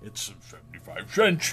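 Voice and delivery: low, comic put-on voice; funny voice. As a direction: say it in a goofy low voice. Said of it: "That was a funny voice you did".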